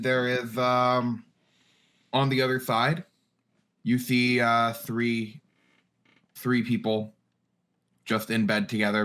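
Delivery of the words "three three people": usual speaking voice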